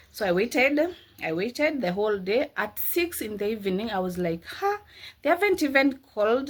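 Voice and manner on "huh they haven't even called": surprised. Retold or imitated. Retelling waiting all day for delivery.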